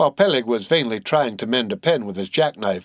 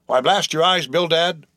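a raised, higher-pitched protest; crying out; 6 semitones higher than usual. A narrator's reading. Captain Peleg calls out to his partner Bildad, lightly raised protest. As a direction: snap at him like a blustering old captain. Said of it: "cried Peleg".

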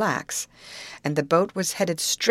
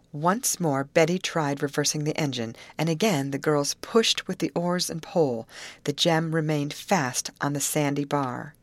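usual speaking voice

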